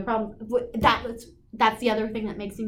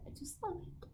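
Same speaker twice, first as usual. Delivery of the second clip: unvoiced, much softer whisper of delight; 7 semitones higher, 23 dB quieter than usual. Real, not acted. Whispering to viewers about her yarn, hushed. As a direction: breathe it like a secret.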